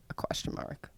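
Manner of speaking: whispered disbelief. Real, not acted. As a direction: whisper it flatly. Disbelief at his reply.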